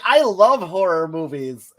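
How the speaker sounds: lightly put-on Hot Topic girl; horror-fan girl. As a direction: imitate a poser slowly enthusing. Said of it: "She's like".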